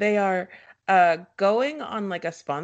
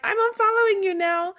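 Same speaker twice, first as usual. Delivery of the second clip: mocking quote; 9 semitones higher than usual. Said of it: "they're like".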